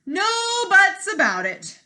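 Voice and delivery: loud, high royal shout; king shouting. Acted. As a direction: shout him down like an impatient king. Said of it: "shouted the king".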